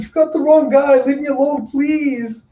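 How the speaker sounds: high, panicked begging; frantic pleading. Acted. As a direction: plead desperately, pitch way up. Bum begs god to leave.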